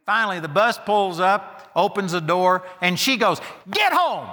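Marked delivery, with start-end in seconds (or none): 3.7-4.3 sharp final command. Retold or imitated. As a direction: put your foot down on the dog. Final sharp order to poodle.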